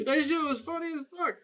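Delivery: high-pitched helpless laughter through the words; really laughing while talking; cracking up. Real, not acted. Cracking up at own imitation.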